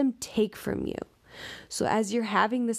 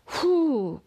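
loud, higher breathed-out whew; acted exhale; 7 semitones higher, 4 dB louder than usual. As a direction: blow out a big calming breath. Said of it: "take a breath".

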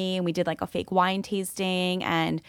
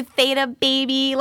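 higher, hammed-up sorority girl squeal; sorority sister; 6 semitones higher, 3 dB louder than usual. Teasing co-host about their sorority side, mock sorority girl. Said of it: "old sorority sister self came out. You were like".

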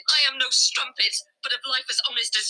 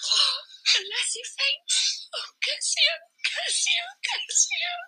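fainting lament; 13 semitones higher than usual. Acted. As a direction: fade into grief, nearly fainting. Woman faints over lover.

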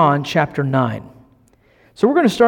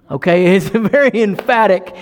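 laughing; 4 semitones higher than usual. Real, not acted. Laughs at own joke.

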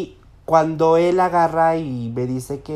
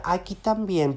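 imitating a child; 3 semitones higher, 5 dB quieter than usual. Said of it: "pero así como lo estoy hablando, como el niño".

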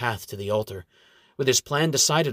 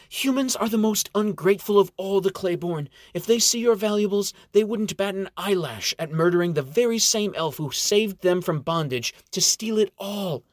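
character voice; 8 semitones higher than usual. A narrator's reading. Xena voiced, higher.

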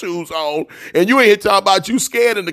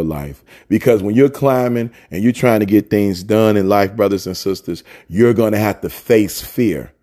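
low preaching; 9 semitones lower than usual. Acted. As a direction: drop low and press it home to the congregation. Sermon exhortation.

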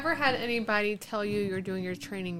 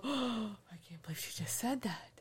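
gasp, then soft mock-shocked whisper; scandalized whisper; 10 dB quieter than usual. Imitating people reacting to her outspokenness, scandalized whisper.

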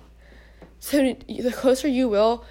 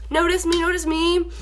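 higher whiny begging; mock plea for attention; 6 semitones higher than usual. Mocking girls begging guys to notice them, whiny. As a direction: beg to be noticed, pitched up.

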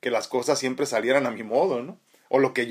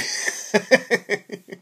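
hearty laugh; 9 semitones higher than usual. Real, not acted. Laughs at late-comer joke.

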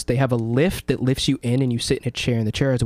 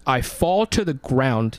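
ordinary delivery

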